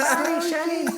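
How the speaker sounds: very high-pitched boxer apology; high little voice, imitating the champ; squeaky mock apology from a big fighter; Mike Tyson. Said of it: "the baddest man on the planet".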